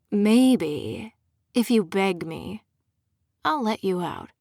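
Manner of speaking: a lightly voiced purring villain; mild purr, toying with her. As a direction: tease it out, smug and purring. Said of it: "he purred".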